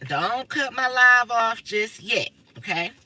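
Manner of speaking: each word stretched out, very slow; slow pleading. Real, not acted. Streamer begging viewers not to cut her live, slow and drawn out. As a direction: drag it out as a pleading request.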